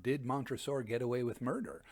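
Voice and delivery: plain reading voice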